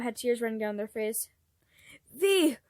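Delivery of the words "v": loud, higher, desperate cry; sobbing shout. Acted. Pranks begging his unconscious brother to wake, shouting through tears. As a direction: scream at him to wake up. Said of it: "Pranks choked a sob".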